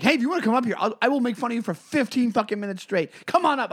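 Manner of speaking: high-pitched retold challenge; fighting challenge. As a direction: replay calling a heckler out to fight. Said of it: "I'm ready to fucking fight this guy".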